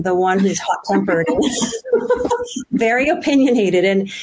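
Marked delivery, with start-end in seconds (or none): 1.5-2.7 short high laugh; amused laugh. Real, not acted. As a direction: laugh at your own fiery reputation. Amused at her own temper.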